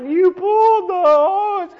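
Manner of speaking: high, slow, drawn-out wail; mock hysterics. Telling the guy he pulled the hose, hysterical wail. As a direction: squeal it out, stretching every word.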